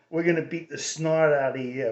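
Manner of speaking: tough South End Irish Boston accent; a light threat in Irish Boston tone. Shown as a demonstration. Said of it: "the typical Irish, Irish tone".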